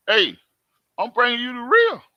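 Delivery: normal talking voice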